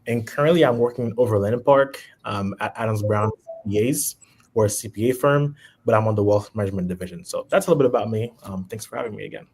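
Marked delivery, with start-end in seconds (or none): none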